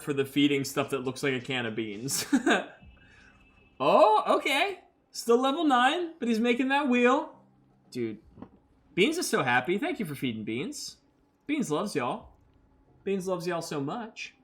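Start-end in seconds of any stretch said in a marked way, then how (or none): 3.8-7.3 high, delighted oh okay; thrilled at a gift; excited surprise. Real, not acted. Streamer reacting to a viewer feeding Beans, delighted. 9.8-14.3 soft thanks. Real, not acted. Thanks chat for feeding.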